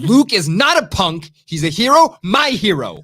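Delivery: loud, high, fervent defense; passionate. Real, not acted. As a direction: defend your hero with fire.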